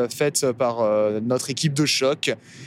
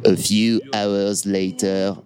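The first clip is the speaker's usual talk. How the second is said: mock trailer voice; 5 semitones lower, much slower than usual. Mock announcer voice for transition.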